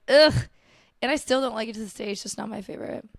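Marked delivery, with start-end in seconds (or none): 0.1-0.5 loud disgusted ugh; disgusted groan. Retold or imitated. Kid groaning loudly when mom says just try it. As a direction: groan like a kid refusing syrup. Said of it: "I'd be like".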